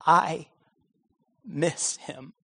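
soft, faltering, near tears; quiet, mildly cracking confession. A narrator's reading. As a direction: let the voice break on miss him. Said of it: "his voice began to crack".

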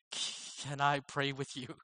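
soft, slow, hesitant retold plea; trembling voice. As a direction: timidly imitate a nervous, halting request. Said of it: "I said in a trembling voice".

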